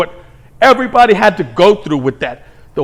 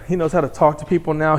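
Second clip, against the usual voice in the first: ordinary talk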